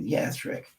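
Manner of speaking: hushed grateful yes. Retold or imitated. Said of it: "You hear the shift in the, in the emotion".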